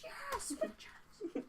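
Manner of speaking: high, whispery little gnome voice; small gnome's squeaky stage-whisper cheer. Acted. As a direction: cheer in a tiny, high, hushed voice. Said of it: "the gnome has climbed up onto the barrier".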